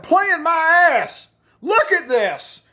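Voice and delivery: high, drawn-out mock yell; angry outburst. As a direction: roar back as the wronged friend, dragging the words. Furious friend confronts the prankster.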